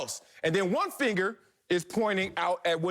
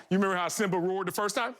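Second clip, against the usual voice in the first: ordinary talk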